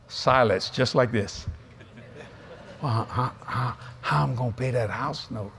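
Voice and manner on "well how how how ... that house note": low, quiet, halting mock worry; worried inner voice. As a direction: fret haltingly as if thinking aloud. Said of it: "I could hear that in my mind".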